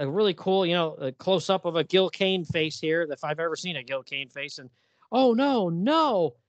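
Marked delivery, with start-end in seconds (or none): 5.1-6.3 higher, louder gasp of dismay; comic-book hero aghast; mock horror. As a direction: play the hero's shock broadly. Hero shocked at the scene.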